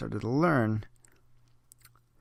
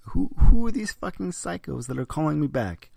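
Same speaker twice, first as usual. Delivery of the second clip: loud, higher, fed-up shout; exasperated yell; 7 semitones higher, 11 dB louder than usual. Real, not acted. Vlogger fed up with scam callbacks, an exasperated shout. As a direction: yell it like you've had it. Ranting about callers.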